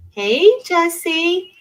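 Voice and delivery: high, drawn-out hello; sing-song greeting. Real, not acted. Drawn-out greeting called to a viewer.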